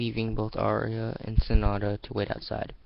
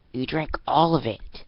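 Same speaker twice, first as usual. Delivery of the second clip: higher, loud accusing disbelief; 5 semitones higher, 6 dB louder than usual. Acted. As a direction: stare at the empty can. Arya sees empty can.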